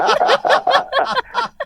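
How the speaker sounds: a high, loud burst of real laughter. Real, not acted. Host laughing in admiration of the guest's voice, loud burst.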